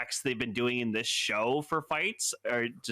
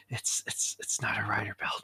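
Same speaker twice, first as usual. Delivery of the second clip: whispering aside; 5 semitones lower than usual. Real, not acted. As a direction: mutter it under the breath. Muttered aside about the belt.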